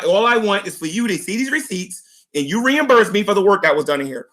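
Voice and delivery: ordinary delivery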